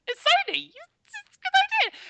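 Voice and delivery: very high, loud squeaky put-on voice; squeaky Sony voice. Mocking an excited Sony justifying its prices, squeaky. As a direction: leap into a cartoonish excited squeak. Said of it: "they do get a squeaky voice".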